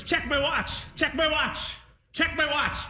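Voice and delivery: high, insistent pleading to check his watch; a proud dad pushing his watch at you; excited old dad. Retold or imitated. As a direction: repeat it like a proud old man nagging. Dad showing off his step count.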